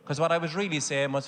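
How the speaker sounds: usual speaking voice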